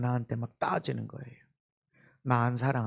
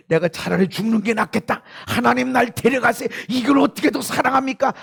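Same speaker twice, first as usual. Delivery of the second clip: exasperated outcry; 9 semitones higher, 9 dB louder than usual. Acted. Voices believer at breaking point.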